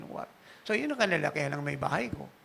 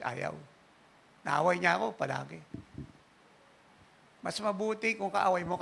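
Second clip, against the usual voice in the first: ordinary talk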